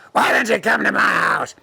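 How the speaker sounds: sudden roaring invitation; El Diablo character. Acted. Devil ordering the girl home, shouted. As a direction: burst into a harsh shout.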